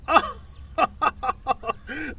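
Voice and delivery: high giggle. Acted. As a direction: burst into a giggle. Finds hanging man funny.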